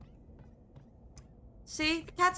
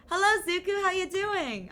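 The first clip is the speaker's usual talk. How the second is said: higher, louder sing-song hello; chirpy greeting voice; 7 semitones higher, 4 dB louder than usual. Acted. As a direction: greet them bright and high like a cartoon. Greeting character in put-on voice.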